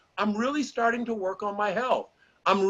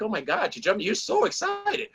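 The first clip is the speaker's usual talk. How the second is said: gushing friend. Retold or imitated. A friend cheering him on, mock gushing. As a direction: play an over-the-top cheerleader.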